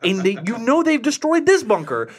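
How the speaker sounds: exasperated rant. Retold or imitated. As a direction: build a frustrated lecture to the screen. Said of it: "I'm like".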